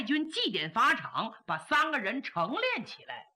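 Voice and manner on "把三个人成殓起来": ordinary delivery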